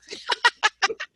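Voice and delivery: high-pitched giggle; real tickled laugh. Real, not acted. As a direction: burst of high, amused laughter. Laughing at own imitation.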